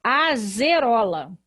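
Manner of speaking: Azerola with a voiced z sound, said slowly; mispronounced Azerola, s read as z; wrong reading. Shown as a demonstration. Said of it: "Aqui eu teria que ler".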